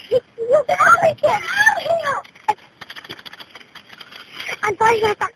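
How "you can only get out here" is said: high, desperate shout; urgent calling out. Real, not acted. Child urging family out of a house fire, urgent shout.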